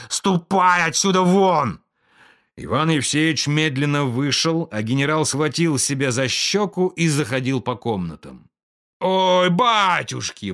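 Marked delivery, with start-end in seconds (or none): 0.1-1.8 loud, slow order to get out; angry dismissal. A narrator's reading. General drives clerk out. 9.0-10.5 higher, slow wail of pain. A narrator's reading. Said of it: "вопил он".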